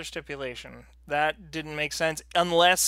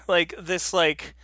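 normal talking voice

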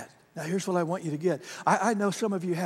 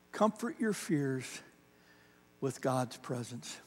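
the same line again, much softer and slow; softer repeat; 5 semitones lower, 9 dB quieter, slower than usual. Shown as a demonstration. Pastor hushing the line for the congregation, soft and slow. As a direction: let it sink in, hushed and slow. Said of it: "pastor repeats line, softer".

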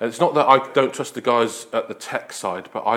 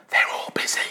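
put-on small, breathy child whispering; child's whisper; 4 dB quieter than usual. Small boy whispers to salesman on phone. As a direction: whisper it like a scared little boy.

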